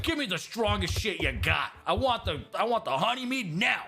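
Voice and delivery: boisterous, demanding tavern voice; rowdy warrior demanding drink. Acted. Game master acts rowdy Beowulf demanding mead.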